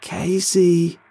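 high, loud call of Casey; shouting. A narrator's reading. Carl shouts at Casey through the window: loud shout. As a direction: shout through the car glass. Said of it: "Carl shouts through the glass".

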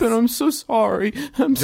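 put-on high, tearful boy's apology; Ango. Boy apologizes to the heroes, high crying voice. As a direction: whimper the apology in a child's voice. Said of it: "You hear Ango crying".

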